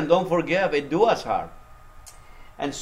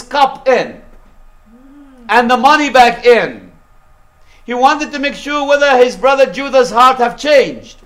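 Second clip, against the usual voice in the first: higher, louder pulpit storytelling; full-force preaching; 6 semitones higher, 7 dB louder than usual. Real, not acted. Preacher tells congregation of Joseph testing his brothers, full-force preaching. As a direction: drive the story out loud and high.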